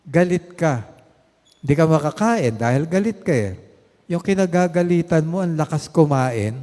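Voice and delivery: preacher's mock outrage; rising indignation. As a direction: paint the unfairness with heat.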